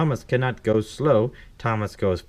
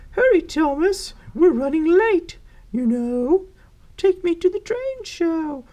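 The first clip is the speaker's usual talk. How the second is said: high-pitched, urgent judge character; high voice fretting about being late; impatient judge; 4 dB louder than usual. Acted. Judge running late urging Thomas, high impatient voice.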